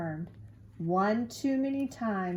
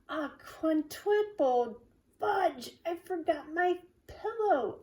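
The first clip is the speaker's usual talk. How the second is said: frustrated schoolboy, pitched up; dismayed child; 9 semitones higher, 3 dB louder than usual. Acted. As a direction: wail it in mock exasperation. Drew dismayed at forgetting.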